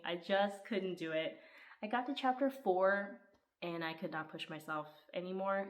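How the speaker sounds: ordinary delivery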